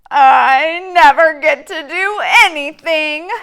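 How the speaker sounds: loud, high whining sob; whiny cry. Acted. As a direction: whimper like a thwarted child. Pigeon denied, whining.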